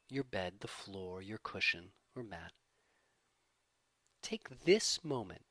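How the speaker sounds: calm, measured voice easing down at phrase ends; guided meditation. Acted. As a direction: gently lead a body scan, unhurried, letting each phrase settle. Leads a body-scan meditation.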